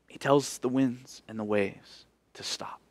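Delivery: quiet awe; whispered narration. A narrator's reading. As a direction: hush as the storm stops. Hushed storytelling of calm.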